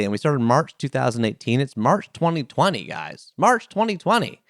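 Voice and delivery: ordinary talk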